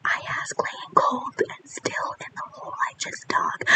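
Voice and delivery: very soft, breath-only whisper; hushed, ghostly whispered verse. Acted. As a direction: whisper it as if lying in a grave. Poet speaks from the grave.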